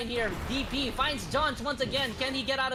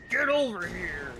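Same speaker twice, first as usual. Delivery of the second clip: slow, lower drawn-out battle cry; Scorpion voice; 4 semitones lower, slower than usual. Retold or imitated. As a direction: bellow it like a fighting game ninja. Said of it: "Scorpion impression".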